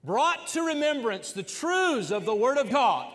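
loud, high, shouted preaching climax; full-force preaching. Acted. Pastor to his church family, preaching at full force.

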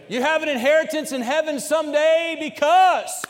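loud, high, shouted preaching climax; full-force preaching. Acted. Pastor to his church family, preaching at full force.

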